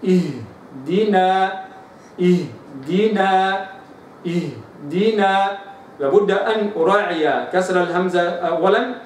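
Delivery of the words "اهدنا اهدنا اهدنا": اهدنا very slow, the h given full length; recitation demo. Shown as a demonstration. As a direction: chant اهدنا with the sakin h held. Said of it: "فأقول في قوله عز وجل". (تلاوة اهدنا ببطء)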